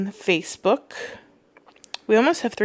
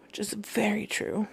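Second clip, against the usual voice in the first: whispered aside; 12 dB quieter than usual. Real, not acted. Aside after the quote.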